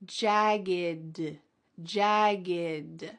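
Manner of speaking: teacher demo of jagged; slow model word. Shown as a demonstration. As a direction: say jagged gently, no puff of air at the end. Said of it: "There's no puff of air".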